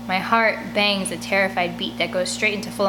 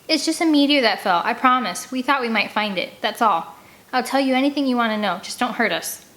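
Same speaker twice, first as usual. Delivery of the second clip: words tumbling out; 4 semitones higher than usual. Acted. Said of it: "The words tumble from my lips".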